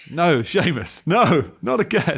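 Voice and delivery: loud, high cry of dismay at Seamus; mock scolding. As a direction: cry out in exasperation, drawing out each "no".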